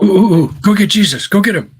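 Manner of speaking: loud, high mock excitement; acted eager urging. Wedding guest sending for Jesus, loud eager urging. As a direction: call out eagerly like a pupil with the answer.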